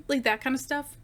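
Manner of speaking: normal talking voice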